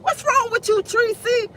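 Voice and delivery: high, exasperated self-scolding; scolding herself. Retold or imitated. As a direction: tell yourself off in disbelief. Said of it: "I'm like".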